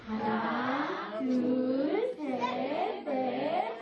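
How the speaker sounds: low, very slow build-up count; drawn-out counting. Pretend. Storyteller counting sling swings with children, low drawn-out count. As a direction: stretch each number low, like winding up.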